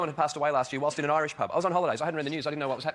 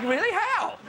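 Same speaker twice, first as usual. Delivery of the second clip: incredulous outburst; 6 semitones higher than usual. Retold or imitated. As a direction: pitch leaps up in mock astonishment. Acted disbelief at friend's comparison.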